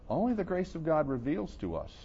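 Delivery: ordinary talk, nothing marked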